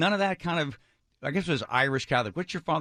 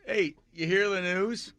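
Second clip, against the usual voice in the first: dad's voice; 9 semitones higher, slower than usual. Retold or imitated. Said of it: "He goes".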